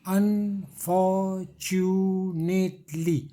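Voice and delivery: "Unfortunately" stretched into five separate beats; "Unfortunately" as un-for-tu-nate-ly, each part held long; syllable by syllable. Shown as a demonstration. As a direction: say "Unfortunately" very slowly, one puff per syllable. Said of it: "पांच हवा का झोंका है".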